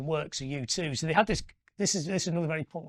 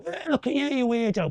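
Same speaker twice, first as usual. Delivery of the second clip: jeering local; 9 semitones higher, 6 dB louder than usual. Retold or imitated. Rough locals jeering at [name].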